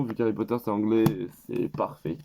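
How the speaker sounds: ordinary talk, nothing marked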